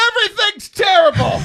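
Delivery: high, loud comic outburst; hammed-up wailing complaint. Man retells unloading on his spiritual director, hammed-up wail. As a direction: shout it as an exaggerated meltdown.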